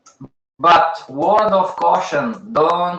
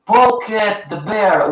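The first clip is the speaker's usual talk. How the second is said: reading a name; 5 semitones higher, slower than usual. Real, not acted.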